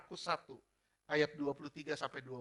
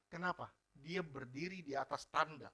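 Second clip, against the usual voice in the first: usual speaking voice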